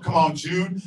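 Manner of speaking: normal talking voice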